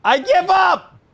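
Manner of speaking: high, loud exasperated cry; frustrated shout. Real, not acted. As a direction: shout it out, defeated. Frustrated giving up.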